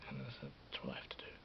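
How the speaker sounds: unvoiced whisper. Shown as a demonstration. Vlogger whispering his plan to himself, whispered. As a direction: whisper it to yourself. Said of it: "whispered then repeated aloud".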